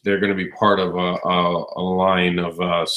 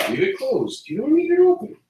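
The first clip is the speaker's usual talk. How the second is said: parent voice. Real, not acted. Telling toddler off, fridge.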